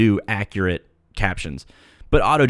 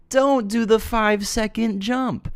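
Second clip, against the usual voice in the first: slow, much higher, drawn-out "Don't"; emphatic repeat; 11 semitones higher, slower than usual. Shown as a demonstration. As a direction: say it again slowly, sky-high for emphasis.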